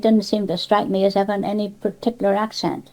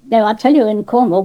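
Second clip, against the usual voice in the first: ordinary talk, nothing marked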